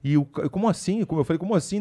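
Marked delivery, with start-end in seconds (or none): 0.5-1.0 mild, higher-pitched surprise; retold bafflement. Retells his baffled reaction to news. 1.4-1.8 mild, higher-pitched surprise; retold bafflement. Repeats his baffled reaction.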